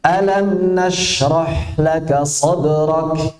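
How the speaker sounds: Alam nashrah laka sadrak recited slowly; slow recitation. Shown as a demonstration. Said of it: "Jangan dibaca alam". (dibaca pelan Alam nashrah laka sadrak)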